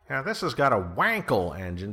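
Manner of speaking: a hint of a high celebrity host parody; Jay Leno impression. Podcaster quotes the car host on an engine, light Jay Leno impression. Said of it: "my best Jay Leno impression".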